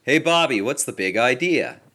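usual speaking voice

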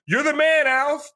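high, lightly voiced excited fan; imitating a fan. Stranger in a car cheering Alf, high and excited. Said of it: "And he was like".

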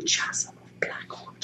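whispered slowly, in awe; awed whisper. Acted. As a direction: breathe the name like a secret. Echoes the place name in awe.

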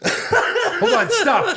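high laughing "hold on, stop"; laughing, pleading with friends to stop teasing; laughing protest. Real, not acted. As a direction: laugh while begging them to stop. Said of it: "Do not make fun of me".

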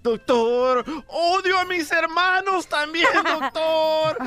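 high, wailing mock sobbing; crying Piolín. Piolín whining to the doctor, high and tearful. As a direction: wail every doctor like a crybaby. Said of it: "dice Piolín llorando".

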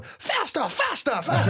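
high-pitched English train voice; squeaky British toy train chanting; English accent. Retold or imitated. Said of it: "Thomas the Train in this English accent's going".

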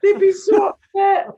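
high, loud comic wail; hammed-up dramatic cry; mock outraged shout. Real, not acted.

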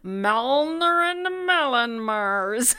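Malnerin Melon Mars drawn out very slowly, MELON stressed; caller's garbled name. Retold or imitated. Retelling the caller repeating the name, Malnerin Melon Mars slowly garbled. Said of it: "And they repeated".

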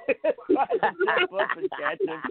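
high, laughing words. Real, not acted. Wrestler recalling a botched moonsault to co-hosts, laughing. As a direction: laugh through the punchline of the story. Laughs retelling the midair mishap.

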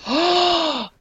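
shocked gasp. Real, not acted. Hearing Cornette blew out knees.

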